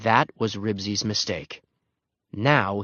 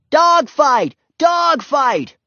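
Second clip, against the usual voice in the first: high, loud, excited yelling; boy's yell; 7 dB louder, much slower than usual. A narrator's reading. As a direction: yell the news to the whole street. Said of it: "a boy yelled".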